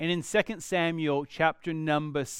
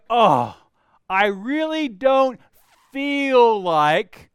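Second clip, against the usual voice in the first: higher, louder reluctant moan; whiny reluctant groan; 5 semitones higher, 8 dB louder, much slower than usual. Retold or imitated. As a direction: whine like you don't want to get up. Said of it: "you wake up in the morning and go".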